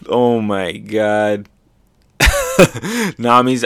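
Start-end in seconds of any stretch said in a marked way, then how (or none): none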